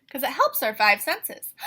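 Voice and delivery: ordinary talk, nothing marked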